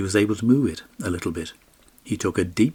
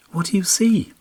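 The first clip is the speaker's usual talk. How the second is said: much higher, light pony voice; talking pony; 12 semitones higher, 3 dB louder than usual. A narrator's reading. Magic pony asking its rider, high and light.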